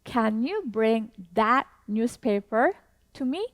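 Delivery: very slow, each word spaced out; slow teacher demo with a rising question end; slow model sentence. Shown as a demonstration. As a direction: model it slowly for learners to repeat. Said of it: "One more time".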